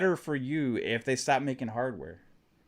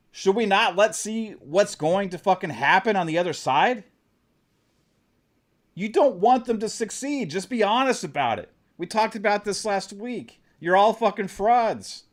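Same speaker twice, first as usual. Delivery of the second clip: heated passion; 6 semitones higher than usual. Real, not acted. Streamer calling out the Xbox haters, heated passionate rant. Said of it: "I'm just talking with passion".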